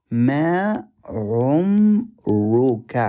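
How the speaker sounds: "ما عمرك" said slowly, stress on ما; slow Arabic model. Shown as a demonstration. Teacher modelling "ما عمرك" for students. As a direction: spell out "ما عمرك" slowly, ما first. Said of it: "It's super easy".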